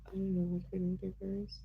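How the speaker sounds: whispered aside to herself. Real, not acted. Streamer whispering her confusion to chat. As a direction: breathe it out under the voice. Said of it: "whisper heard".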